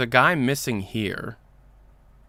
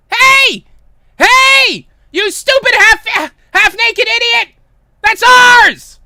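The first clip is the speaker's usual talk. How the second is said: very high, furious full shout; hysterical screaming; 17 dB louder, slower than usual. Acted. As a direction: scream after a thief from far away. Pauline screams at plane thief.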